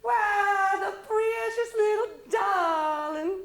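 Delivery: high, loud, tearful gush. Acted. Mama gushing over the baby, high and loud. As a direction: coo over the darling child.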